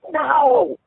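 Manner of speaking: very high anguished scream. A narrator's reading. Father seeing his child is a boy, screaming. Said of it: "he screamed".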